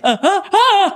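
laughing mock yelp, high and squeaky; embarrassing yelp. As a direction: imitate a startled man's yelp. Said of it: "you yelp, you don't, like, yell".

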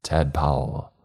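usual speaking voice

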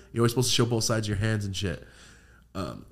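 usual speaking voice

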